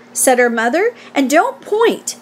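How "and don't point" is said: slow, higher motherly reproof; mild scolding. A narrator's reading. Mother to pointing Fern, mildly scolding. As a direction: correct a child's manners deliberately.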